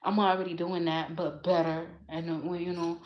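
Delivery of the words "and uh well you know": ordinary talk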